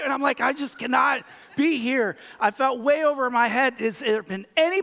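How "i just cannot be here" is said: panicked outburst. Retold or imitated. Panicked self retold.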